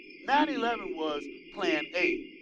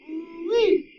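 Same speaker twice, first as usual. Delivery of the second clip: ooh-wee; 11 semitones higher, 10 dB louder than usual. Real, not acted. Gleeful exclamation on air.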